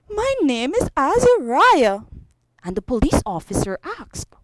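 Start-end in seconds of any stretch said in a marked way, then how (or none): 0.1-2.0 lightly voiced tearful child; higher, small-boy answer; upset little boy. Lost boy telling the officer his name, mildly upset.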